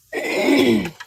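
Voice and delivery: a loud rough throat clear. Real, not acted. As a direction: clear your throat hard. Hoarse voice.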